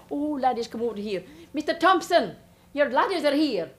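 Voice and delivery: calling out. Acted. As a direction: hail the boys' father over the crowd. Calling the boys across crowd.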